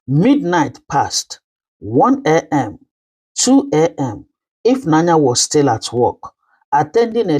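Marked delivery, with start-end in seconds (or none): none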